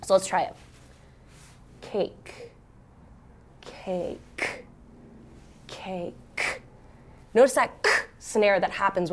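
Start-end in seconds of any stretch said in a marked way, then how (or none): 1.8-6.6 slow, whispered cake; quiet slow demo word; really slow. Shown as a demonstration. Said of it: "say it really slow".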